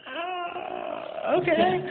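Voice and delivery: high, dozy mock junkie "ah, okay"; nodding-off junkie. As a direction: imitate a guy half asleep on dope.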